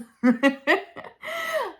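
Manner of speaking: high-pitched laughing fit; amused laugh. Real, not acted. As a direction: crack up after your own mocking impression. Laughs after mimicking critics.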